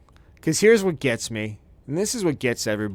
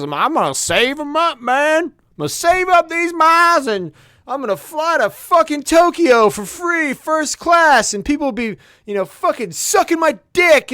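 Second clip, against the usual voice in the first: much higher, loud dopey voice; mock naive self; 14 semitones higher, 5 dB louder than usual. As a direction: play a clueless bragging idiot. Mocking his own naive past self.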